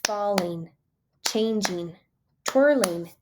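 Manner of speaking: "falling", "changing", "twirling" set apart by pauses; clapped-out syllables. Shown as a demonstration. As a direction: count two beats each on "falling", "changing", "twirling". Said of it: "I like to clap 'em out".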